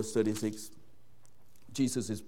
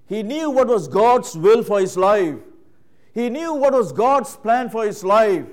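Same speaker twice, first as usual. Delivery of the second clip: higher, louder emphatic sermon climax; full-force preaching; 10 semitones higher, 13 dB louder than usual. Acted. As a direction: drive the point home from the pulpit.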